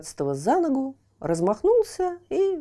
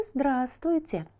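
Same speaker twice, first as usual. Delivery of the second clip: slow, falling, quieter hello; softer greeting; 5 semitones higher, 4 dB quieter, slower than usual. Acted. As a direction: settle the greeting down, ominous. Water greets chick.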